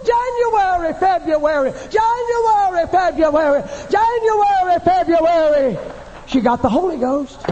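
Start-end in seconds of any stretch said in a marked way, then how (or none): 0.1-5.8 high cry, falling on each word; fervent crying out. Acted. Hungry woman prays the only words she knows, crying out. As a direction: cry each month out long like a prayer. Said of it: "she just said".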